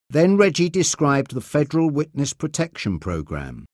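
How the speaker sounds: usual speaking voice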